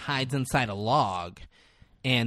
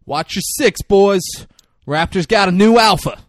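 louder, higher movie-hero command; hero's called warning; 7 semitones higher, 9 dB louder than usual. Retold or imitated. Chris Pratt warning his boys in the forest, called out. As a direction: call a warning out to the squad.

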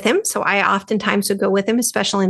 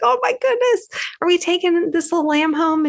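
put-on much higher, thrilled childlike squeal; excited little girl; 8 semitones higher than usual. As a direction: burst with joy at getting the lamb. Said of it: "I was like".

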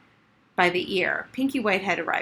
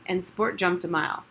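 ordinary delivery, nothing marked

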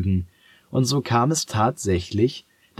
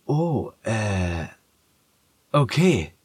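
hesitant cat; 3 semitones higher, slower than usual. Acted.

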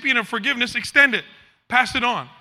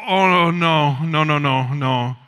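kindly refusing; 7 semitones lower, much slower than usual. Retold or imitated. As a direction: let him down kindly, trailing off. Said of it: "you know, kindly".

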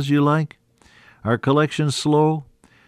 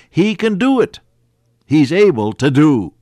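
rising preacher's shout; 3 semitones higher, 6 dB louder than usual. Acted. Preacher's climax.

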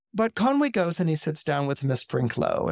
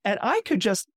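higher, eager voice of a pious missionary; zealous missionary; 4 semitones higher than usual. Retold or imitated. Miss Brinklow gushing to Conway about her schools, higher and eager. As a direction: gush about saving the heathens, climbing to a squeal at the end.

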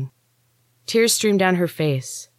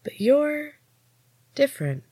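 drawn out; 11 semitones higher than usual. A narrator's reading. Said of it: "drawing out each word".

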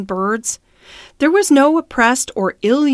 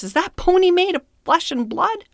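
high, stern rising question; stern challenge; 9 semitones higher than usual. A narrator's reading. Master confronts the driver over the pony, stern challenge. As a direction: demand an answer sternly, pitched high. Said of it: "he cried in a stern voice".

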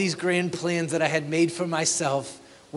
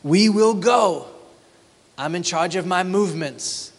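arrogant boast; 4 dB louder than usual. Retold or imitated. Pastor mocks a merchant's pride, loud swagger. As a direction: puff up and declare it. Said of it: "Do you feel it?".

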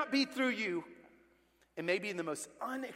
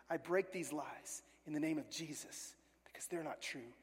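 whispered prayer; 5 semitones lower, 9 dB quieter than usual. Retold or imitated. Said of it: "And she said".

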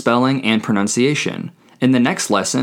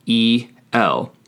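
slow, separated letters; spelled out. Shown as a demonstration. Teacher spelling the word for he, slowly letter by letter. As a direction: spell it out one letter at a time.

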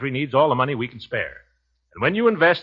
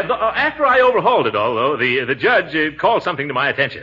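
ordinary talk

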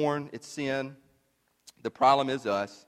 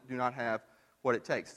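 ordinary delivery

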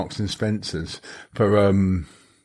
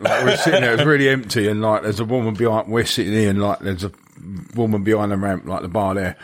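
ordinary delivery

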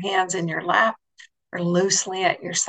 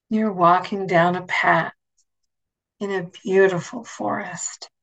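guided meditation. Acted. Leads a guided imagery meditation.

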